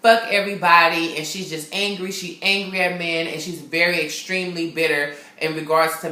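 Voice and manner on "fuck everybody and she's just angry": ordinary delivery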